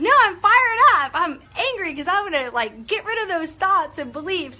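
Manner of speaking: high, loud retold outburst; acted fired-up anger. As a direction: imitate someone loudly fired up. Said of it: "somebody was doing angry".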